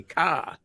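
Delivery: "car" said as a drawn-out "kah", no R; New England pronunciation. Shown as a demonstration. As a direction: drop the R in "car" and leave an open "ah". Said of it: "like the letter K A".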